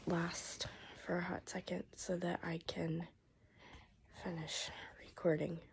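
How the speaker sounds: hoarse whisper; voice giving out. Real, not acted. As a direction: voice nearly gone. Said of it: "my voice will last".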